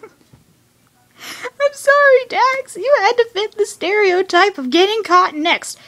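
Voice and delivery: high-pitched voice through laughter; laughing apology. Real, not acted. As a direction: squeak out the apology while laughing. Laughing apology to a cartoon character.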